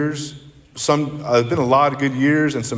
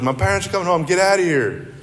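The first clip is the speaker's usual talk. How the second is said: put-on higher, urgent girl's voice; panicked warning; 6 semitones higher than usual. Girl rushing guests out, higher urgent warning. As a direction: hurry them out before her parents arrive.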